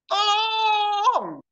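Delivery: Tolong! shouted very high and loud; desperate cry for help. Shown as a demonstration. Preacher showing how a real plea for help sounds, screamed high. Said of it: "Tapi kalau orang minta tolong gimana?".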